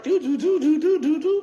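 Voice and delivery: high robot beeps. Pretend. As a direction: do a robot chanting do. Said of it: "I'm a robot".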